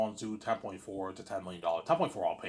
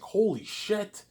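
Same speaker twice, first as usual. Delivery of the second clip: stunned exclamation; 8 semitones higher, much slower than usual. Retold or imitated. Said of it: "He's like".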